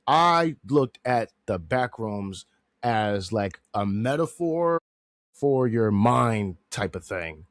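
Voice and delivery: slow, halting explanation; slowly piecing the thought together; talking slow. Real, not acted. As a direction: spread the words out as you think. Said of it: "sorry if I'm talking slow".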